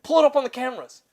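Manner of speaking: high, loud urging; eager demand, imitated. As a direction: imitate an excited coworker demanding proof. Retold coworker eager to see footage.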